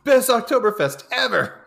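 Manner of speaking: high, loud hammed-up cheer; whooping festival-goer. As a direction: yell it like an overjoyed reveller. Playing a cheering festival crowd.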